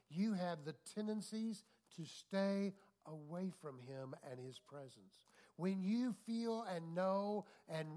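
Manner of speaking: loud, slow, emphatic preaching; full-force preaching. Acted. Preacher urging the church toward God, loud and slow. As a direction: drive it home to the congregation.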